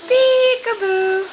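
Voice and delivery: drawn-out peekaboo. Pretend. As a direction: stretch it out to tease the baby. Peekaboo game with baby.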